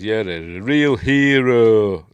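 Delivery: loud, drawn-out sarcastic retort; hammed-up sarcastic praise; mock sarcasm. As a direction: stretch it out slowly in mock admiration. Retold bitter retort to apology.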